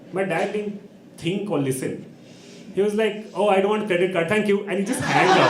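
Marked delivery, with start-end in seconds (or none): 3.3-4.6 mock-irritated, higher and louder; raised-voice comic dismissal; irritated dad. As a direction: brush off a telemarketer, loudly. Busy dad brushes off caller.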